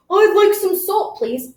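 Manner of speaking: high, slow, lightly put-on kid voice; child customer voice. Teacher voicing Amir asking for salt: lightly voiced child. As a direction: ask politely like a little boy.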